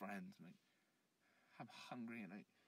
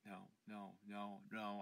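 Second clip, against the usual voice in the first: plain reading voice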